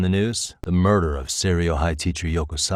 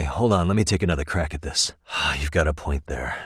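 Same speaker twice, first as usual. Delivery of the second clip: normal talking voice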